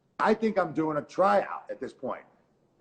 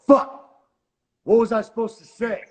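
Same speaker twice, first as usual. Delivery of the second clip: panicked outburst; 8 semitones higher, 8 dB louder than usual. Retold or imitated. Retold panic, forgot his lines.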